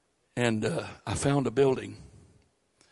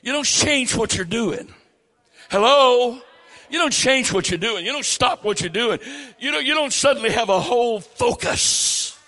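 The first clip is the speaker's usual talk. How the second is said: higher and louder preaching, "Hello?" called out to the crowd; full-force preaching; 9 semitones higher, 6 dB louder than usual. Real, not acted. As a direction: press the point home to the crowd.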